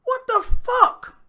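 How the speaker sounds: slow, high, loud disbelief; stunned shout. Real, not acted. Hearing the clip, shouting in stunned disbelief. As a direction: drag out the shock.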